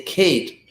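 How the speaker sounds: "Kate" with a puff of breath on the K; aspirated K. Shown as a demonstration. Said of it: "you're breathing out more".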